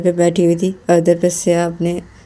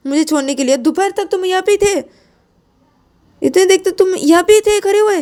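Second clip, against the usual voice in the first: much higher, fast scolding rant; angry quarrel; 11 semitones higher than usual. Acted. Shreya keeps scolding Shruti for waiting till evening, high and fast. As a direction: lay into her without pause. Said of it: "झगड़ा कर रही हो तुम भी?".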